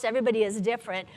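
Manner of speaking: normal talking voice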